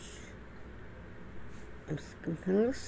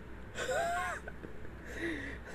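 shrill crying laugh that fades out; half laughing, half crying, very high; 10 dB quieter than usual. Real, not acted. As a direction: break into a high wailing laugh. Laughs at garage dentist idea.

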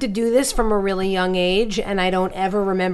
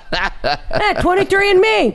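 brash New York bit; 9 semitones higher, 3 dB louder, slower than usual. Pretend. Joining the crude host bit.

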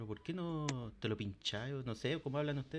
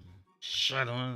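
slow, louder, stretched-out curse; drawn-out groan curse; 6 dB louder, much slower than usual. Real, not acted. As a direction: drag the word out in exasperation. Maldice dos veces el ensayo. (maldición alargada y fuerte)

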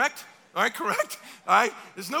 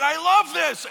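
higher, louder "I love this"; delighted exclamation; 6 semitones higher, 8 dB louder, slower than usual. Pretend. Believer loving their work in heaven, delighted. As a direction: gush with sudden joy.

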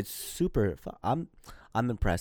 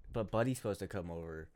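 mumbled quietly; 9 dB quieter than usual. Real, not acted. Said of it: "I can't hear you".

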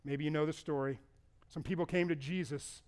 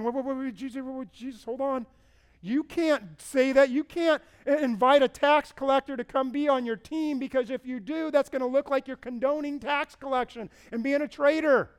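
higher, louder flustered protest; alarmed objection; 6 semitones higher, 6 dB louder than usual. Acted. Crowd protesting to Jesus, higher and louder in alarm. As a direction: sputter in disbelief at Jesus.

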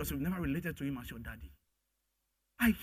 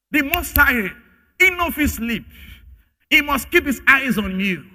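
shouting preach; 7 semitones higher, 10 dB louder than usual. Real, not acted. Preacher stressing God's constant watch.

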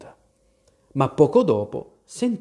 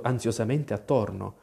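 usual speaking voice

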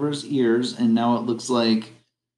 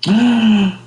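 high, loud delighted gasp; excited gasp; 10 semitones higher, 7 dB louder than usual. Acted. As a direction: gasp with sudden joy. Puppet delighted at offer.

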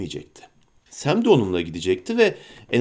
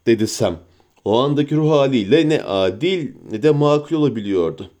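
usual speaking voice

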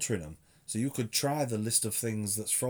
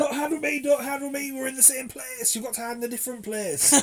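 put-on much higher, louder kid voice; childish high voice; 13 semitones higher, 3 dB louder than usual. Recalling childhood tig games, in a high kid's voice. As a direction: whine like a little child at tig.

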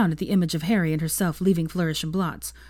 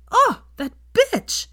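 slow, much higher, outraged; indignant outburst; 6 dB louder, much slower than usual. Acted. Hermione curses the reporter to Draco, outraged. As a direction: spit out the insult.

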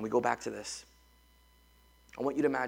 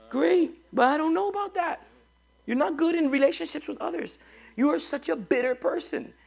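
high, raised rebuke to the room; scolding preaching; 14 semitones higher, 8 dB louder than usual. Acted. Pastor rebuking bitter Christians in the room, raised high voice. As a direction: call them out sharply.

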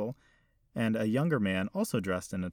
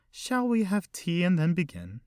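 put-on elderly lady; 9 semitones higher, 5 dB louder than usual. Old hostess voiced by narrator.

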